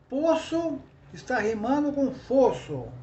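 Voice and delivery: ordinary talk, nothing marked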